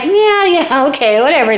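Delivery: lightly acted brush-off, high then dropping; dismissive princess. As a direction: wave Dad off with a high "yeah, yeah". Princess brushes off father's threat.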